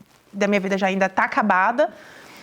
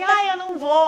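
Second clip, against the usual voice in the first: mock excuse; 8 semitones higher than usual. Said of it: "A pessoa fala assim".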